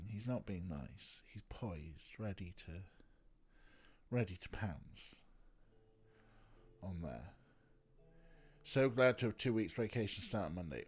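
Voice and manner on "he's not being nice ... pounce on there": hushed commentary. Real, not acted. Narrating cat about to pounce.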